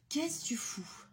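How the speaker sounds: hushed whisper at the cat. Real, not acted. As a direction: whisper it sharply. Scolding the cat.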